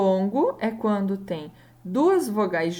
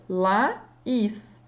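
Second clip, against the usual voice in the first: "Laís" split into La, then ís; separated syllables. Shown as a demonstration. Teacher showing Laís as a hiato, syllables separated. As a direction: pause inside "Laís" between the vowels. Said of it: "fazer essa pronúncia separada".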